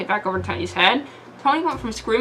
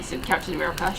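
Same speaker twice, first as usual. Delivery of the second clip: usual speaking voice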